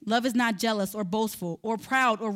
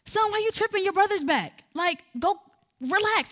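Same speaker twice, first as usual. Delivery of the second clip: higher, brushing-off dad; a father brushing off his upset son, mock dismissive; 8 semitones higher than usual. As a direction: wave the son's hurt away, mockingly. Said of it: "That father could have been like".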